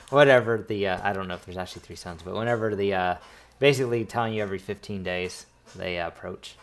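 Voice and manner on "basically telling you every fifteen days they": usual speaking voice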